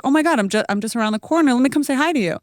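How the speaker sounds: high, bright-eyed rush to meet up; eager shaman. Retold or imitated. Shaman offering to drop by the boutique, high and eager. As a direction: push to come over right away.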